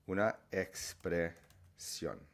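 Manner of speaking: una expresión said slowly, single s; slow Spanish demo. Shown as a demonstration. Teacher modelling una expresión slowly for learners. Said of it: "remove that double S".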